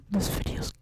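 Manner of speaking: hoarse stage whisper to the viewers; forceful, confiding whisper; whispered aside. Real, not acted.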